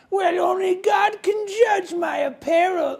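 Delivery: high, slow, mock-outraged shout; mock indignant troll. Pantsless vendor defending his apparel, high mock shout. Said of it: "And they're".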